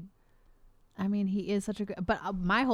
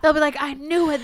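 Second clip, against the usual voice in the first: laughing; 4 semitones higher, 7 dB louder than usual. Real, not acted. Laughing along.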